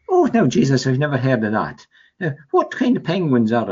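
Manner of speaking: hook, line and sinker amazement; amazed radio interviewer. Retold or imitated. Radio interviewer quoted in story.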